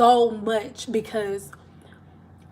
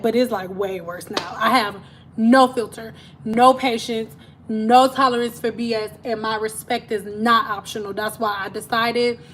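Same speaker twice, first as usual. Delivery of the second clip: ordinary delivery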